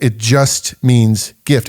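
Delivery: slow, landing hard on gift; slow, deliberate stress on gift; slow emphasis. Shown as a demonstration. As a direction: plant the word gift slowly. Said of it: "And that's important".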